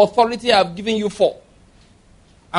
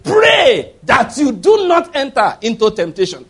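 slow, loud, high preaching shout; thundering slow warning; shouted command; 5 semitones higher, 5 dB louder, slower than usual. Retold or imitated. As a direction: hammer each word like Jesus' command. Jesus quoted in sermon.

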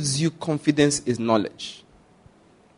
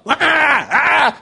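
demon shouts; 10 semitones higher, 3 dB louder than usual. Pretend. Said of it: "they come and shout".

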